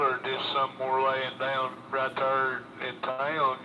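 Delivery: ordinary delivery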